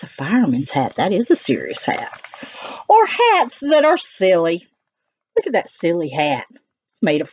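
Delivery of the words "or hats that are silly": lively storybook reading, higher on key words; sing-song it for the little ones; animated read-aloud of the dog's rhymes; reading to children. Acted. Reading rhyming text.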